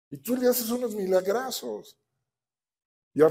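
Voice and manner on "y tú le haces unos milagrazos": sarcastic complaint. Retold or imitated.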